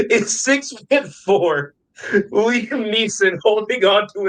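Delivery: barely getting the story out for laughing; laughing through words. Real, not acted. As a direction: crack up while telling the absurd climax. Cracking up retelling absurd owl scene.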